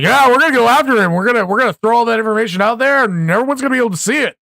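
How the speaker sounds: imitating big talk, high, loud and rushed; mock gung-ho promise. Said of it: "they were like".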